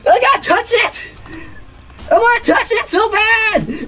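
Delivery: very high, frantic squealing; overexcited squeal. Real, not acted. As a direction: squeal with desperate eagerness. Overexcited urge to touch game figure.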